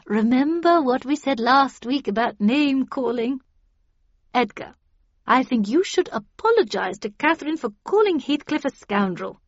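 high, lilting counsellor voice; patronising group leader keeping order; placating Miss Havisham. A narrator's reading.

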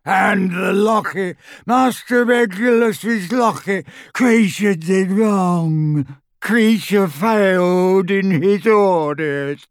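high slow wailing elf shriek; screaming house elf. A narrator's reading. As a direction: wail it out in anguish. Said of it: "he uttered a blood-curdling scream".

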